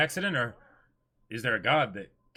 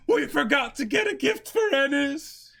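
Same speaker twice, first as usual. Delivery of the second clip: high slow wail; 13 semitones higher, 5 dB louder than usual. Retold or imitated. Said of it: "He's like".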